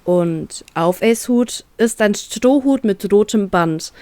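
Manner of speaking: usual speaking voice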